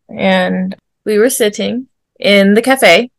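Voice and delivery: normal talking voice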